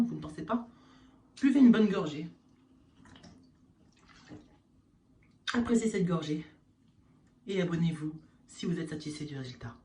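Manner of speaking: infomercial. Acted. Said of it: "continuer la F A Q".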